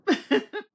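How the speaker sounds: loud, high laugh; high burst of laughter. Real, not acted. Narrator laughing at finding the book on her shelf, high laugh. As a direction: laugh out at yourself.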